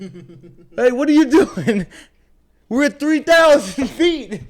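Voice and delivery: high, loud, laughing alarm; panicked buddy. Retold or imitated. Retelling the skydive, the buddy yelling in laughing alarm. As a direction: play the buddy freaking out mid-air, laughing. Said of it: "he's like this, and he's like".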